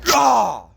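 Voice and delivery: short straining shout while tearing; effort yell. Real, not acted. Vlogger tearing the box open by hand, straining yell. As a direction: grunt it out as the box gives.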